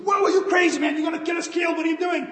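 high, loud alarmed yell at the driver; panicked shout. Retold or imitated. Passenger yelling at reckless driver, panicked.

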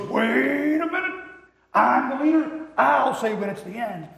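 slow, high, loud cartoon dog voice, drawn out; bossy hound dog. Preacher voicing the cartoon hound dog bossing his sidekick, loud and high. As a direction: play the pompous dog who insists he leads. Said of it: "And he goes".

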